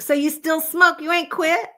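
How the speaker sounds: high, disbelieving challenge; incredulous. Retold or imitated. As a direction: call her out in disbelief. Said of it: "I'm like".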